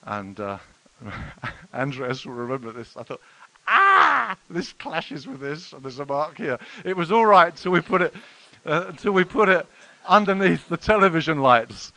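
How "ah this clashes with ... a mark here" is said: a high startled "Ah" then a slow dismayed note; dismayed gasp. Retold or imitated. Preacher recalling the tie under the TV lights, high startled dismay. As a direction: act out spotting the clash in horror.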